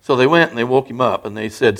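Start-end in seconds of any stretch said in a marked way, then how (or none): none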